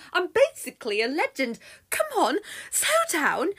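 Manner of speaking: high, loud, exasperated bragging; indignant boasting Apollo. Acted. Apollo chases Daphne listing his glories, indignant and loud. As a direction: shout your credentials after someone escaping. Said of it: "if he could catch his breath".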